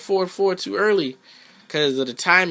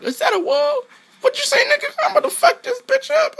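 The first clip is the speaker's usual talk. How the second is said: very high, louder cartoonish voice; high squeaky character voice; 4 dB louder than usual. Gamer voicing his fighter spotting the wall, squeaky high cry. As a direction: squeal it like a hyped-up little character. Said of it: "I'm like".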